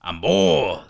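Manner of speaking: loud grunting boar voice; wild boar. Acted. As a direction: bellow it like a boar. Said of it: "doing Boar's voice kinda hurts".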